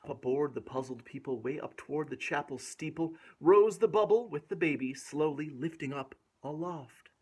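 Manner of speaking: put-on lilting read-aloud rhythm for a child; rhymed story reading. A narrator's reading. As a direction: bounce through the rhyming verse. Rhyming verse continues.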